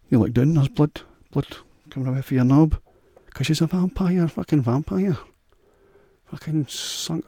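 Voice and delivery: mock alarm as the joke peaks; building shock. Punchline of the joke, rising.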